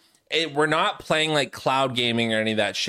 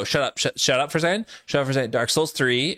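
rapid, repeated cut-offs; shutting someone down; much faster than usual. Acted. Constantly cutting the listener off about Dark Souls, rapid shutdown. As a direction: rattle it off to block any reply. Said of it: "just shut you down and go".

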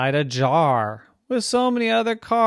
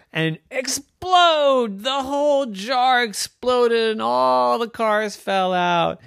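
high, loud explosive narration; excited climax; 12 semitones higher, 7 dB louder, slower than usual. A narrator's reading. Narrator tells the jar exploding, excited. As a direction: burst out and keep it high.

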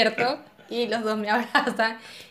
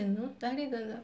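softly dropped answer, quoting a friend; quiet retold reply; 10 dB quieter than usual. Friend answering her at night, quiet retold reply. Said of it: "Me dice".

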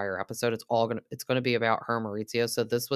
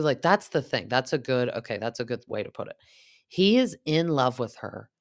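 usual speaking voice

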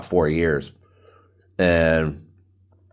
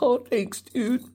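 high falsetto; 6 dB quieter, slower than usual. Retold or imitated. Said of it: "I'm like".

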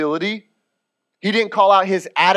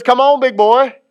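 playful Jesus; 4 semitones higher, 6 dB louder than usual. Retold or imitated. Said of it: "And Jesus says".

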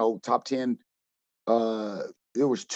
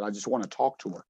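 low, soft imitation of a grieving player; subdued retelling of a tearful plea; retold tearful plea; 5 semitones lower, 8 dB quieter than usual. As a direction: quote the plea quietly, without sobs. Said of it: "he was crying for a while".

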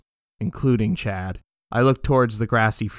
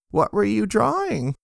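high voiced question; 12 semitones higher than usual.

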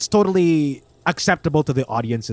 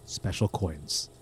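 hushed deadpan; 6 semitones lower, 11 dB quieter than usual. Retold or imitated. Pitching a silly plot idea.

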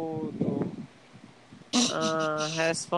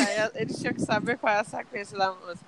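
usual speaking voice

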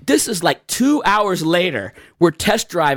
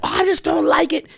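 imitating his mom; 11 semitones higher than usual. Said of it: "And she's like".

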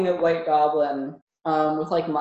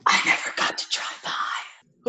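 faint disembodied whisper; ghostly whisper. Acted. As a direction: whisper it like a ghost inside their head. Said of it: "a little voice in your head".

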